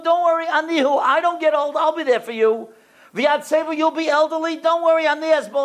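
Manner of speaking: high, ringing preacher's voice speaking as God; preaching God's promise. Acted. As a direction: lift every line up with fervour.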